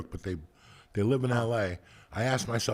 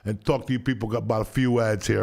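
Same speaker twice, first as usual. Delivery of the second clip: normal talking voice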